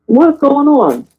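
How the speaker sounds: high, disbelieving what's going on; incredulous. Retold or imitated. Mother reacting to son's dramatic slide, incredulous. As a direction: exclaim in mock amazement at the drama. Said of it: "I said".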